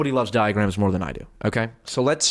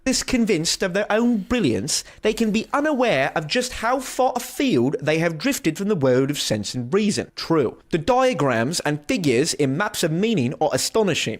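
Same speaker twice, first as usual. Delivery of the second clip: mock British reading voice, pitched up at first; British accent; 5 semitones higher than usual. Reading the critic's quote aloud in a mock British accent. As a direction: read it out as a put-on Englishman. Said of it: "I'll do it in a British accent".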